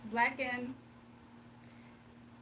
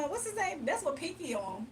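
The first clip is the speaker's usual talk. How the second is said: ordinary talk, nothing marked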